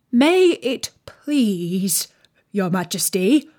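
slow, halting, deferential address; very humble. A narrator's reading. Gardener Two addresses the Queen, very humbly. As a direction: plead humbly, drawing out the words. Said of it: "in a very humble tone".

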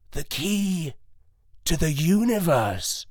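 a lightly voiced old dog, slow and solemn. A narrator's reading. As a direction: reveal it like a last secret. Old dog reveals key.

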